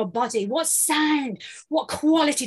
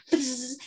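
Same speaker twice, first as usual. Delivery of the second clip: a softer, close little bee buzz; 5 dB quieter than usual. Shown as a demonstration. Coach showing a close, soft bee buzz. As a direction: buzz gently, the bee is right at your finger. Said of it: "It's quite close, it's not very loud".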